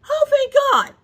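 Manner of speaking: very high, loud cry of relief; dramatic high-pitched relieved yell. Retold or imitated. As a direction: blurt it out way up high. Retold loud relief at no babies.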